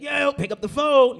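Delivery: high, slow, very loud yell; yelling inmate. Acted. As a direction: holler down the line to be heard. Inmate yelling over automated call.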